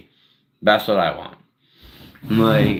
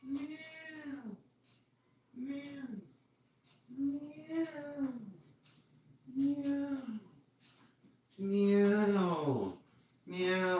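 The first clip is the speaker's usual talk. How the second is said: much higher mewing meows, cat imitation; 13 dB quieter, much slower than usual. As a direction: mew like a small needy cat. Imitating his cat meowing.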